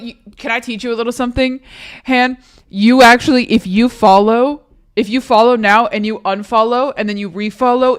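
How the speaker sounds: usual speaking voice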